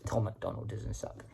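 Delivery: whisper. Real, not acted. Defiant aside defending the artist.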